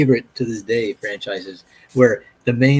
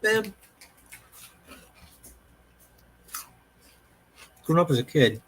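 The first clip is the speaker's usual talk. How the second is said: chewing while talking; mouth full; 5 semitones higher than usual. Real, not acted. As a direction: talk through a mouthful. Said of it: "Sorry, I'm chewing".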